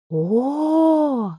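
high, long-held "oh"; high-pitched, lingering startled moan; drawn-out cry. A narrator's reading. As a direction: stretch it out in sudden alarm. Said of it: "вдруг протянула Живита".

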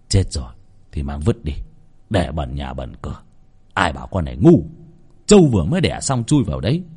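put-on low-pitched, unfeeling old master; cold, callous master. Master ordering servants to dump the body, cold. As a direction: dismiss the death coldly in a low voice. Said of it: "vẫn lạnh lùng đứng trên hiên".

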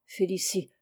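ordinary delivery, nothing marked

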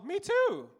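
high, chirpy, clueless reply; squeaky oblivious agreement; naive chirp. Retold or imitated. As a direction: play it dumb and bright.

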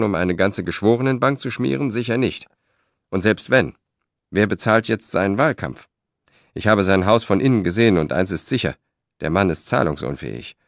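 plain reading voice

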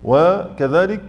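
ordinary delivery

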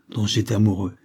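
ordinary delivery

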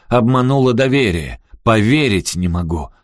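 loud, high accusation falling off; forceful, indignant outburst; barking. A narrator's reading. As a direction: bark in outrage. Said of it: "рявкнул Робби".

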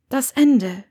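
lightly voiced woman answering firmly; Hermine. Hermione's answer, higher character voice.

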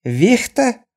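high, questioning trader; Chinese merchant voice. A narrator's reading. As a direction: ask back in a high voice. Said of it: "переспросил китаец".

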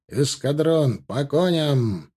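long drawn-out parade-ground call; military command. Acted. Officer orders the squadron to mount, drawn-out command. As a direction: stretch the order out for the whole squadron.

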